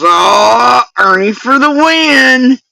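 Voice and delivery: high, loud, drawn-out mock cheer; imitating his cheer. Replaying his reaction to show the chat, mock cheer. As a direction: stretch the words and climb in mock triumph. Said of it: "And he goes".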